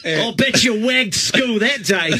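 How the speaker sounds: put-on Aussie voice, laughing through it; light Australian jock impression; mock Australian accent.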